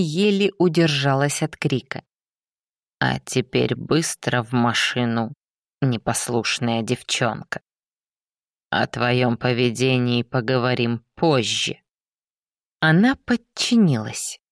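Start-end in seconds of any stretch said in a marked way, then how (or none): 3.0-11.8 low, cold parental scolding; a hint of a stern father; fire demon.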